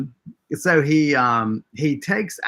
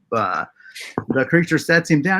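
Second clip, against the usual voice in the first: ordinary delivery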